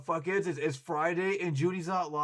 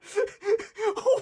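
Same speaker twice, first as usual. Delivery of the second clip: laughing; 6 dB louder than usual. Real, not acted. Bursts out laughing at chat.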